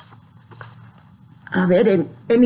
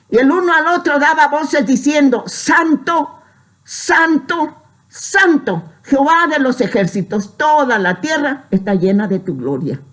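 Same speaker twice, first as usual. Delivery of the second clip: high ringing proclamation, easing down at the end; solemn scripture recitation; 6 semitones higher than usual. Acted. Preacher quoting the seraphim to the brethren, solemn proclamation. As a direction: proclaim the angels' praise in separate phrases.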